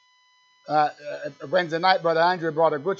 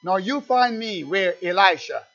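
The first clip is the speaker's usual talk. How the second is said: raised, higher preaching voice, driving hard; fiery preaching; 4 semitones higher, 6 dB louder than usual. Acted. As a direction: preach it out to the congregation at full force. Preacher at full pitch.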